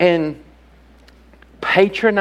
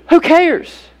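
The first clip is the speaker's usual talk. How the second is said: higher, louder, exasperated; exasperated outburst; 9 semitones higher, 5 dB louder than usual. Real, not acted. Preacher cutting off denominational excuse, loud and higher. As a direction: throw it off with a loud retort.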